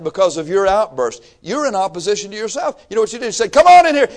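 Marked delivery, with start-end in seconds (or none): 3.5-4.1 loud, high mock invitation; acted shout of welcome; calling out. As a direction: holler it like calling someone in.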